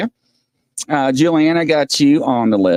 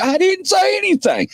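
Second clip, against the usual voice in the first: mock protest. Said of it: "Peter's like".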